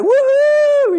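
very high, loud celebratory whoop; elated whoop. Acted. Friend cheers at good news.

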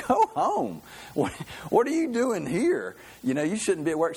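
high-pitched, incredulous exclamation; incredulous boss. Acted. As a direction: play a boss aghast at a worker, voice jumping up. Acted boss reacting in a joke.

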